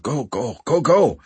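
plain reading voice

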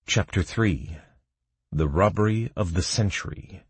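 ordinary delivery, nothing marked

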